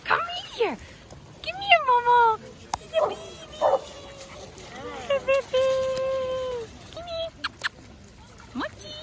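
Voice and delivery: high-pitched cutesy calling; pet-talk voice. Real, not acted. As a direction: coax a dog in a tiny squeaky voice. Coaxing an animal at beach.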